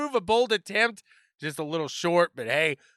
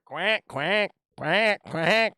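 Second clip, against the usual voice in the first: put-on duck quacks; playful duck-call imitation; duck quacking; much slower than usual. Mock duck calls for the sitting duck.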